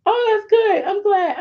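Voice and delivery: very high, squeaky pretend delight; retold over-eager reassurance; forced cheerfulness. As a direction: act out covering nerves with squeaky approval. Covering emotion before her son, retold.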